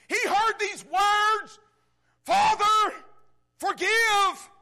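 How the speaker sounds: loud, high preaching cry; preaching shout. Real, not acted. Preacher crying out the words from the cross, full-force shout. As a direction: lift the hall with it.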